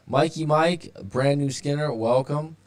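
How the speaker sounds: upbeat, high welcome; excited greeting. Real, not acted. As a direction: welcome them in with energy. Greeting a new live viewer.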